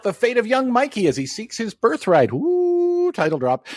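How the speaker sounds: high, energetic intro read; show intro announcer. Acted. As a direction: hype up the episode like a promo.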